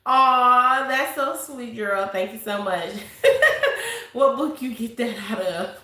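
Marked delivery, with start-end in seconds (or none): 2.9-4.1 airy high-pitched giggle; breathy laugh. Real, not acted. Laughing at viewer's poem.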